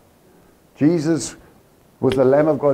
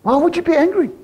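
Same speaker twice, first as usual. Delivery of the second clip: can't believe anyone would be angry; incredulous, higher and louder; 13 semitones higher, 4 dB louder, faster than usual. Acted. Preacher challenging the sulking older brother, incredulous. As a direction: pitch it way up in disbelief.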